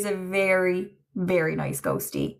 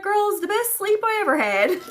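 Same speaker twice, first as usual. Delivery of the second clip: much higher, gleeful, as if the ghost is beaming; high playful quote; 12 semitones higher than usual. Retold or imitated. Medium voicing a ghost's words to his mother, much higher and gleeful. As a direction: squeal it out like a delighted spirit.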